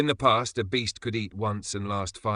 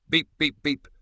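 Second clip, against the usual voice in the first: high, loud beep beep beep; phone beeps; 7 semitones higher, 5 dB louder than usual. A narrator's reading. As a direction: imitate a dead line beeping. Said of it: "The line cuts off".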